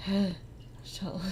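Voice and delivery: ordinary talk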